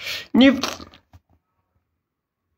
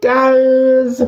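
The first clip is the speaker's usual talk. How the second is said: higher excited call; 10 semitones higher than usual. Acted. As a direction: shout out to the group. Calling out.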